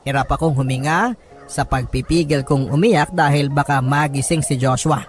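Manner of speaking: plain reading voice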